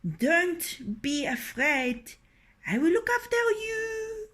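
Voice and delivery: husband calming his frightened wife, high and proud; reassuring rooster. Acted.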